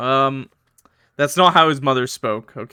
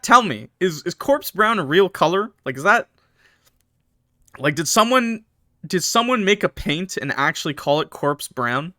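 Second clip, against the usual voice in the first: normal talking voice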